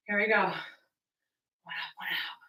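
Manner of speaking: breathy whispered breathing cue; whispered count. Real, not acted. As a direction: whisper it under your breath. Breathing cue during exercise.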